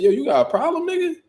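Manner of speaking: high, sharp challenge; incredulous high-pitched challenge; retold confrontation. Confronting the older man.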